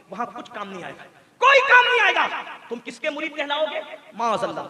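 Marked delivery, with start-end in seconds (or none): 0.1-1.0 low and very soft; subdued, grave preacher's aside; hushed warning. Real, not acted. Warning said low, then cried. 1.4-2.2 high, loud preacher's cry; sudden full-voiced outburst; shouted warning. Real, not acted. Same warning repeated as a cry.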